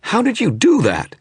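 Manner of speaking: high, angry snarl. A narrator's reading. Stung image snarling at the wizard, angry and high. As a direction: snarl it after the slap. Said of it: "it snarled".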